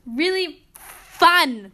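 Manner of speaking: heavy sarcasm, FUN long drawn-out; sarcastic. Real, not acted. Driving home the test complaint, heavy drawn-out sarcasm. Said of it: "That was sarcasm".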